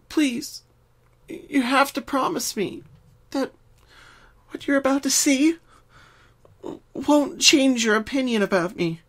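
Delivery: high, tearful pony plea; pleading. Acted. Pinky begging, near tears.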